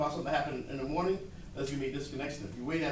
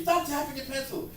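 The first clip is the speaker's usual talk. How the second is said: raised, higher shout at a classmate; mock scream; 11 semitones higher than usual. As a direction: snap it out like an annoyed kid yelling.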